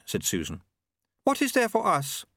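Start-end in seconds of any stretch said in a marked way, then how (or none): 1.2-2.2 high, prim and polite; a higher, refined lady's voice; Lady Lejeune voice. A narrator's reading. Lady Lejeune politely answering Susan, in a higher refined voice.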